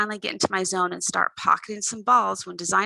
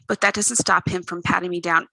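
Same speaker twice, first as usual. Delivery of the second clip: plain reading voice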